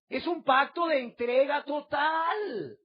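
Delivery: slow, fervent shouted cry on pacto. Acted. Pastor crying the key phrase at the congregation, shouted cry. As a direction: cry the phrase out, drawn and loud. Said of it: "repeated with a cry".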